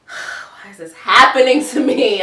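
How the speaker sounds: slow, loud mock lament; hammed-up exasperated groan of self-pity; mock despair. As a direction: bemoan it theatrically, drawing it out. Acted lament after a let-down.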